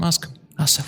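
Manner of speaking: normal talking voice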